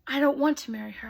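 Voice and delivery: quick refusal. Acted. Prince refusing the father.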